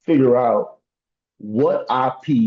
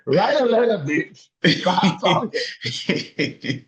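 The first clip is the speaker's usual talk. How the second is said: higher, laughing, very slow on stop talking; laughing mock order; 5 semitones higher, much slower than usual. Playing the inmate warning his friends, laughing. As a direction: scold the homies while cracking up. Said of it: "you wanna call them immediately".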